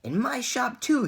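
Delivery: high, loud excited yell. A narrator's reading. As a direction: yell it out in triumph. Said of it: "he yelled".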